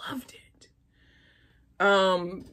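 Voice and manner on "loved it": soft whispered gush. Real, not acted. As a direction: breathe it out like a secret.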